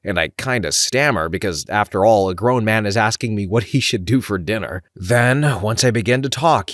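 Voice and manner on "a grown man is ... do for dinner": ordinary delivery, nothing marked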